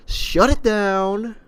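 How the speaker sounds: high, loud, drawn-out sign-off; hammed-up call. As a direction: stretch it out like a mock command.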